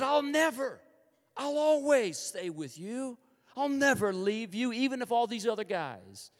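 high, loud, cocky pledge; arrogant boasting. Acted. Peter bragging to Jesus he will stay, arrogantly. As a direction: boast it grandly, drawing out the promise. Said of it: "he is arrogantly speaking of his awesomeness".

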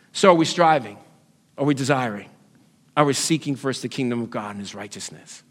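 soft, low, sober self-examination; searching questions. Real, not acted.

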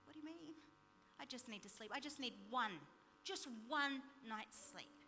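very soft, subdued plea; desperate pleading. Retold or imitated. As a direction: beg quietly for one night's rest. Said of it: "I was desperate".

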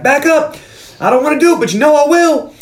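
high, strained mock yell; desperate warning. Zeus with a gun warning the gang, desperate warning.

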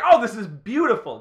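delighted. Retold or imitated. Imitating delighted fan.

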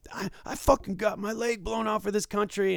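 high, loud mock yell; angry yell. Dealer quoting the veteran's outburst, high loud mock yell. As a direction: spit it out like an outraged vet. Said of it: "And goes".

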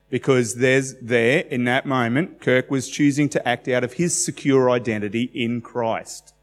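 normal talking voice

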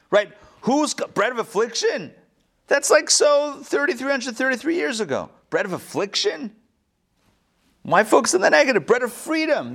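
high-pitched mock indignation; hammed-up objection for laughs; joking protest. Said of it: "Anyway, all jokes".

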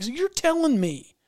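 high-pitched disbelieving challenge; retold incredulity. Guide challenging the veteran turkey killer, high incredulous voice.

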